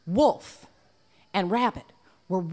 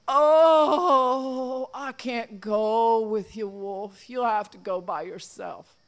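fake sick whimper; 3 semitones higher, 3 dB louder, slower than usual. Rabbit faking weakness.

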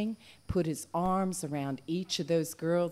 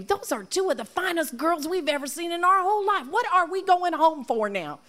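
exasperated protest; 10 semitones higher than usual. Acted. Wolf protesting to Rabbit.

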